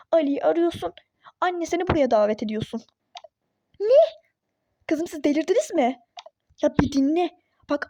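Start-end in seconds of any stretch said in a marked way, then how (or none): 3.8-4.2 high, loud shocked outburst; shocked what. Real, not acted. Duru told to invite her mother-in-law, shocked high loud outcry. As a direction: blurt it out in disbelief.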